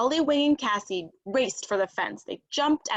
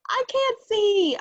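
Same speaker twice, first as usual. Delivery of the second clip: much higher, louder, slow panicked cry; blinded turkey calling out in alarm; distressed cry; 13 semitones higher, 6 dB louder, slower than usual. Acted. Sweatband pulled over his eyes.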